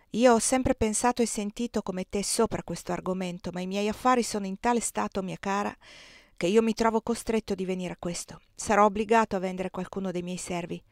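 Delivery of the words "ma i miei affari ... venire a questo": ordinary delivery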